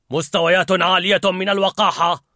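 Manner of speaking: soldier's report. A narrator's reading. Dad's Fear reporting like a soldier. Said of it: "كجندي".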